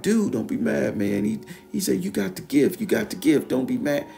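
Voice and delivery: very high, frantic begging; squeaky pleading voice. Retold or imitated. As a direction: beg in a squeaky panic. Concussed Ralph, very high pleading.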